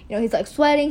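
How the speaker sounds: usual speaking voice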